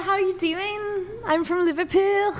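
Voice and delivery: put-on Scouse accent. Friend attempts Liverpool accent for the stream, slow Scouse lilt. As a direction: play a Liverpudlian saying hello, slowly. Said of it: "that's, like, the best I can do".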